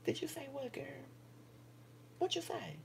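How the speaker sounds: high, faint, away from mic; off-mic question. Real, not acted. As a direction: call to someone across room. Asks off mic.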